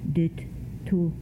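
ordinary delivery

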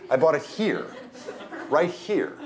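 "here" pushed up high; stress on here. A narrator's reading. Bag lady revealing where the bread was bought, "here" stressed high. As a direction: punch "here" both times.

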